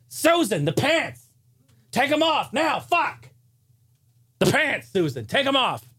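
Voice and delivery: high pitched mock yell at Susan; hammed-up shriek of frustration. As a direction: pretend yelling at Susan to take the pants off, high mock yell. Mock yelling at Susan about pants.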